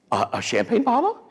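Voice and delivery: high stammered question, imitating a shocked wife; mock incredulous question. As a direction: act taken aback, pitch climbing at the end.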